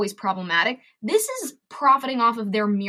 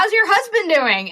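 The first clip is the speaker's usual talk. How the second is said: high, bright mock question; chirpy friend; 10 semitones higher, 5 dB louder than usual. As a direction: play the cheery friend asking. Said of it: "mimicking a friend asking".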